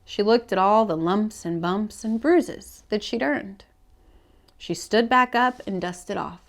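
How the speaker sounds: plain reading voice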